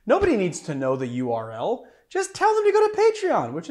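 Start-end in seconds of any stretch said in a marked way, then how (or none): 2.1-3.5 squeaky mock voice, much higher and louder; high silly voice mocking a friend. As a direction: parody a friend's bossy advice in a silly high voice. Mocking Marius's advice.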